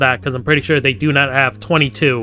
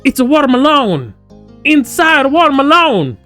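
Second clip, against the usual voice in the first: silly outburst; 12 semitones higher, 7 dB louder than usual. Pretend. Gamer goofing on the watermelon line, silly high holler. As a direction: belt it out as a silly exclamation.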